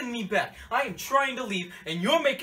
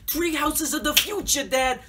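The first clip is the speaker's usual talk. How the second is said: normal talking voice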